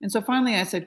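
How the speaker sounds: ordinary delivery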